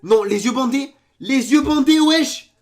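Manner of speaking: loud, high disbelief; incredulous shouting. Real, not acted. Reacting to a blindfolded solve, incredulous shouting. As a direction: shout it out, rising in outrage.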